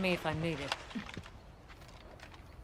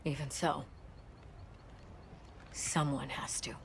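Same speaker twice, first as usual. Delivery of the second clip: whisper; 2 semitones lower, 8 dB quieter than usual. Acted. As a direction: let it fall out as a whisper. Grim reply in hushed tone.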